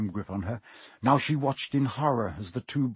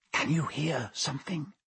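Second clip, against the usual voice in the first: whisper; 2 semitones higher, 7 dB quieter than usual. A narrator's reading. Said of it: "Ron whispered".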